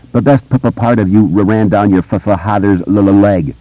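put-on stammering boy character; halting stutter on consonants. A narrator's reading. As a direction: repeat the first sound before getting the word out. Stuttering boy's dialogue in narration.

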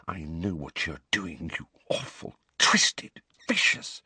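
soft, seething whisper, fading to breath. A narrator's reading. Hermione whispering her hatred of Umbridge. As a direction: spit the words out in a hiss of a whisper. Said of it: "she whispered".

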